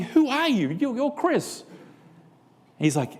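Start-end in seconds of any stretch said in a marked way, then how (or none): 0.0-1.6 incredulous. Retold or imitated. Shocked reply to coworker.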